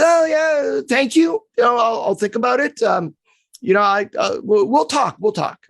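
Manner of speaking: high, breezy brush-off. Retold or imitated. A player politely brushing off his agent. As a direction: toss it off as a polite dodge.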